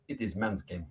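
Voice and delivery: quiet, mock Bulgarian referee delivery. Bulgarian ref shrugging off a foul, mock accent, quietly. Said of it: "the Bulgarian ref went".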